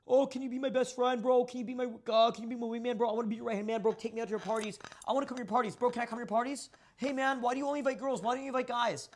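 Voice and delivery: high, loud, whiny mocking voice of needy fans. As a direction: mimic a desperate wannabe begging, hammed-up. Mocking needy fans' DMs.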